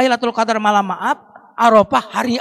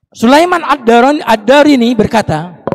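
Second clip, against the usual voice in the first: preaching announcement; 4 semitones higher, 10 dB louder, slower than usual. Acted. Announcing a quote.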